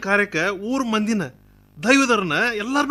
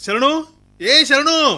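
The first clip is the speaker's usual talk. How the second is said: raised, high-pitched summons; calling out; 7 semitones higher, 3 dB louder, much slower than usual. Acted. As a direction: call someone over from a distance. Calls his manager from elsewhere. (ಜೋರಾಗಿ ಕೂಗಿ ಕರೆಯುವುದು)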